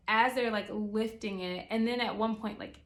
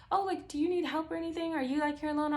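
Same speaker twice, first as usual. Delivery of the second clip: mock neighbour offer; 7 semitones higher than usual. Neighbour voiced offering help.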